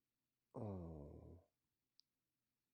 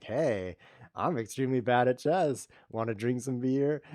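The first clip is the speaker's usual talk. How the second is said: party introduction; 4 semitones higher, 8 dB louder, slower than usual. Retold or imitated. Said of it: "you're like".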